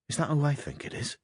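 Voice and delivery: high, stunned question; father's horrified astonishment; shocked disbelief. A narrator's reading. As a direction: ask it aghast, pitched well up. Father shocked by picture of daughter.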